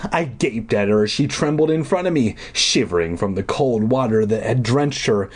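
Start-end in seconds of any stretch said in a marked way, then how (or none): none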